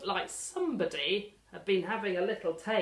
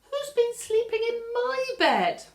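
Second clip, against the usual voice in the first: a high, fussy mother bear asking; Mummy Bear; 9 dB louder than usual. Acted. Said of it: "And I said".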